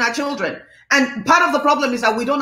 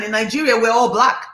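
ordinary delivery

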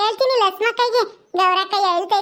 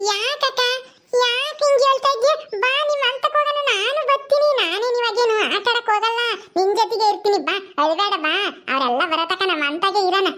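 put-on childlike Paddu, pleading. Paddu promises Gowrakka company instead of play, childlike pleading. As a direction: coax naively, eager to help.

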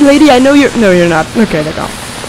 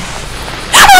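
high breathy laugh. Real, not acted. Sudden scared laugh.

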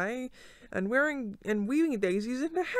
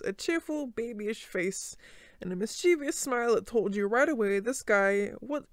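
plain reading voice

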